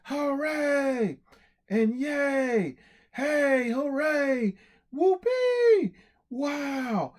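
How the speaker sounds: high excited cheers, shouted out; cheering crowd. Acted. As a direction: cheer like a whole crowd at a parade. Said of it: "the people were cheering".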